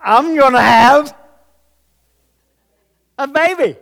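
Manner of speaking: loud, high cry of big news; shouted announcement. Acted. Preacher acting Sarah's baby news, shouted high and loud.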